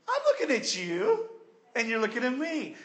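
high, loud sassy retort; sassy comeback. Retold or imitated. Dieter snapping back at a friend, sassy. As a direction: fire it back with a smirk. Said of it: "It's like, well".

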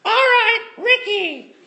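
high, loud bark of a stern swim instructor. Retold or imitated. Swim instructor calls nervous kid Ricky to the board, loud and high. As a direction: play the scary instructor calling him up. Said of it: "all I remember was".

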